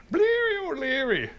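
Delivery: mock Irish leprechaun voice; Irish accent. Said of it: "whatever this attempt at an accent".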